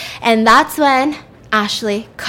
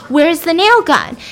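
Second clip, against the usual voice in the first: louder, demanding shout; angry; 7 semitones higher, 5 dB louder than usual. Retold or imitated. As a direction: demand it, furious. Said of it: "She goes".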